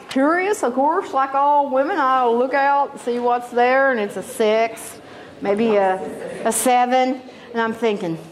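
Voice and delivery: normal talking voice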